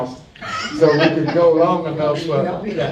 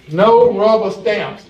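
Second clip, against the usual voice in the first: "no rubber stamps" said slow and louder, each word hit; slow it down and punch "no rubber stamps"; stress on no rubber stamps; 3 semitones higher, 4 dB louder, slower than usual. Real, not acted. Moderator punching the slogan.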